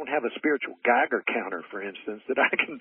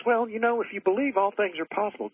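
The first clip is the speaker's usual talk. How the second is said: Jesus line again but much higher, lightly mocking; 9 semitones higher than usual. Retelling Jesus to the boy's father, high mock voice. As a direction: pitch it way up as if aping a sing-song sage. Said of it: "And Jesus says".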